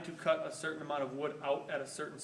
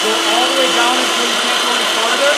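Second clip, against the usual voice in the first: louder, higher voice over a running saw; raised voice over machinery; 6 semitones higher, 24 dB louder than usual. Real, not acted. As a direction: call out instructions over the saw noise.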